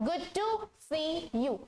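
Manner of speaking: very slow and drawn out; slow model phrase. Shown as a demonstration. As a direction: say it slowly for learners to copy. Teacher models phrase slowly.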